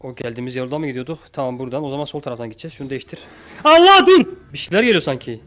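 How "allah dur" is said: very high, very loud shriek; terrified scream. Real, not acted. Player startled by a threat, terrified scream. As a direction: scream in sudden fright. Jump scare.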